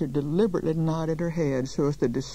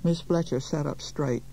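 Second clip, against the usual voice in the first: ordinary delivery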